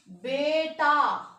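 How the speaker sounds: बेटा said very slowly and firmly; slow careful word. Shown as a demonstration. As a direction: spell out बेटा by sound. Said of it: "ध्यान से सुनना". (बेटा धीरे-धीरे साफ़ उच्चारण)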